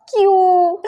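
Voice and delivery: high, drawn-out "why"; indignant wail. Retold or imitated. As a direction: wail it in protest at the unfairness. Indignant at being hit publicly. (ऊँची, खिंची हुई शिकायती आवाज़)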